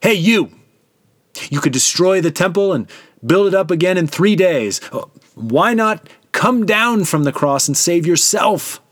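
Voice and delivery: high, loud taunting voice, slowing at the end; mocking jeer. A narrator's reading. Bystanders taunting the son on the cross, high loud jeering. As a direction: jeer up at him with scorn. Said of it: "shaking their heads in mockery".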